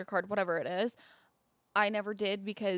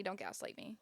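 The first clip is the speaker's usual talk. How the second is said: whispered; 2 semitones lower, 12 dB quieter than usual. Real, not acted. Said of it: "I went after Zach immediately".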